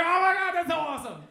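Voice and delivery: high, very loud gushing; thrilled. Retold or imitated. Happy for his aunt, loud high gush. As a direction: burst out delighted. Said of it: "I was like".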